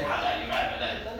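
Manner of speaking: how not to react: hammed-up indignation; mock outrage. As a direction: pretend to tell the driver off.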